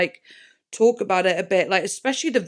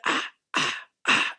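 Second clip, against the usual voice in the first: whispered ah; 5 dB quieter than usual. Retold or imitated. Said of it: "like whisper".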